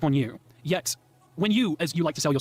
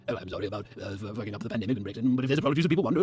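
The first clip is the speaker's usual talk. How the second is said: much lower, softer, bumbling apology; a dismissive British politician mumbling an apology; Boris Johnson parody; 8 semitones lower, 3 dB quieter than usual. Retold or imitated. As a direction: mumble a half-hearted sorry like a politician. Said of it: "Boris Johnson thing".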